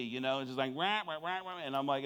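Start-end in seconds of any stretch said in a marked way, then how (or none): 0.7-1.6 honking, wordless muted-trumpet chatter; comic droning squawk; Charlie Brown's parents. Retold or imitated. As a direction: imitate a cartoon grown-up's wah-wah babble. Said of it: "she sounds like Charlie Brown's parents".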